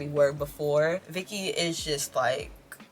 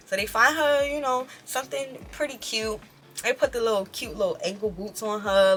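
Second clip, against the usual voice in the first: normal talking voice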